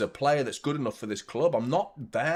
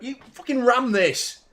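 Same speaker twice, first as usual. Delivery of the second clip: higher, sharp swear; angry outburst; 11 semitones higher, 4 dB louder than usual. Real, not acted. Streamer swearing at the ram error, angry. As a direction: snap at the computer.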